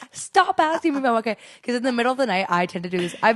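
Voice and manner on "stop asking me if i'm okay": higher, mock-annoyed retort; mock irritation. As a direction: imitate an irritated sick boyfriend. Mimics sick boyfriend snapping at her.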